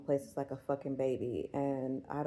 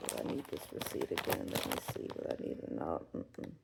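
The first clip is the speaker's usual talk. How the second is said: whispering; 13 semitones lower, 5 dB quieter than usual. Real, not acted. Muttering to herself over a receipt.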